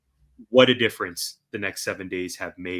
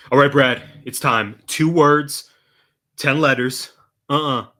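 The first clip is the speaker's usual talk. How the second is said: louder, higher game-show buildup; hyped announcement; 5 semitones higher, 9 dB louder than usual. Real, not acted. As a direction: tease the big reveal like a host. Building up to the segment title.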